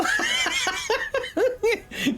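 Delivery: high-pitched cackling laugh; uncontrolled high cackle; real belly laugh. Real, not acted. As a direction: burst out laughing, high and helpless. Cracks up after welcoming them.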